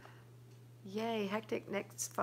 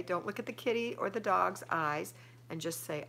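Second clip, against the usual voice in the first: ordinary talk, nothing marked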